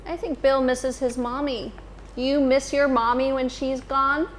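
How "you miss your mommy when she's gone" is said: plain reading voice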